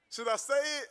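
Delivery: high, giggling question through laughter; laughing. Real, not acted. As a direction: laugh nervously as you ask. Hesitating before saying curse.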